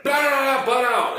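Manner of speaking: very high mock yell; short-fused outburst, retold. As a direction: snap as if re-living a lost temper. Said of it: "very, like, a lot shorter fuse".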